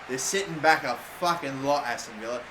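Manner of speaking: ordinary talk, nothing marked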